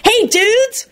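very high, breezy dude greeting; cheerful call. A narrator's reading. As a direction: hail them like a goofy pal. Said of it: "called out cheerfully".

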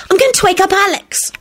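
high, urgent plea; pleading. A narrator's reading. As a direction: press them in a high, pleading voice. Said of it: "chimed in pleadingly".

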